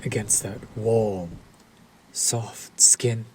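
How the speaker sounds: breathy seductive whisper. Acted. Vampire leaning close to the hunter, whispered. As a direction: whisper it as if tasting the words.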